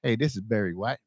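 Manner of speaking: lightly imitated low, soft singer's voice. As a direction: drop low and quiet for the impression. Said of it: "do me a favor and say".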